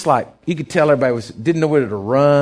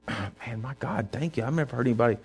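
grateful guest, soft; 4 semitones lower, 8 dB quieter than usual. Retold or imitated.

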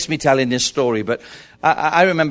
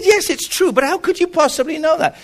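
much higher, amazed disbelief; 12 semitones higher than usual. Retold or imitated. Son asking the stranger, amazed, much higher. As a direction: son can't believe he's recognized.

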